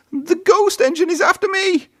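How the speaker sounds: high, wailing terror; panicked wail. A narrator's reading. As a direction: wail it racing past. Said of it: "wailed Thomas".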